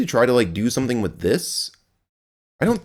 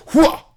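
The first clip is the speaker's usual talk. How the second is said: high sharp jump yell; a quick grunting shout as you leap; effort yell; 6 dB louder than usual. Shown as a demonstration. Streamer showing the jump with a high yell. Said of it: "if I could swing from here and go like".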